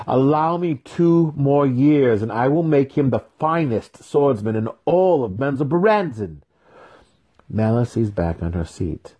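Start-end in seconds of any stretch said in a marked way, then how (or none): 0.1-6.4 urgent plea. Acted. Weapon master bargaining with Matron, urgent. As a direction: beg for time with rising passion.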